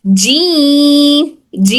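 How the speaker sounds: usual speaking voice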